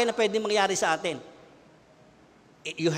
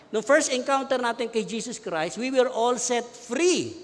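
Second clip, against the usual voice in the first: slow proclaiming preach; 4 semitones higher, slower than usual. Acted. Preacher to congregation, slow proclaiming preach. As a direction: stretch each word out like a proclamation.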